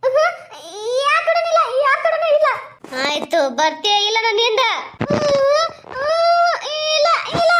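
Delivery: high, pleading village woman voice, close to tears; frightened woman. Acted. A scared woman begging the ghost to leave her, high tearful plea.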